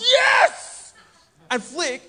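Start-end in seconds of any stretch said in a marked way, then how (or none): 0.0-0.9 a huge high triumphant yell; shouted yes. Retold or imitated. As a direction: scream it like a cricket wicket just fell. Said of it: "shouted at the top of my lungs".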